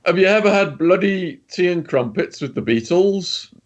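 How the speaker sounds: mock British accent, pitched well up; hammed-up fake British toff voice. Old mafioso easing a nervous cellmate, fake British accent. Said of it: "in a fake British accent".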